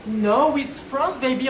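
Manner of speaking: loud mocking yell; a Parisian snapping back at a tourist; loud taunt. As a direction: shout it in their face.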